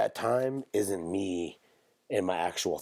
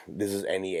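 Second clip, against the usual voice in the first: ordinary delivery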